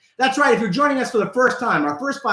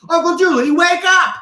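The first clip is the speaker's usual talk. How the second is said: put-on kid yelling; 10 semitones higher, 4 dB louder than usual. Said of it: "he yells at the T V".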